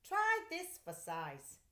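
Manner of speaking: high, slow teasing offer; playful father. A narrator's reading. Father offering the envelope, slow and high. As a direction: hold out the envelope with a grin. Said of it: "he said".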